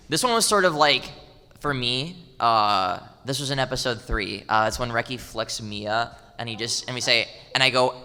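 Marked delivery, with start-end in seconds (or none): none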